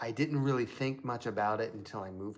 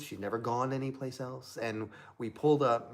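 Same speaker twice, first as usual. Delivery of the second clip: ordinary talk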